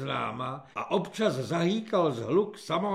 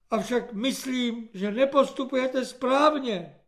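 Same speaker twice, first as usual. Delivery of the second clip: earnest comrade; 8 semitones higher, 4 dB louder than usual. A narrator's reading. Earnest comrade addressing corporal.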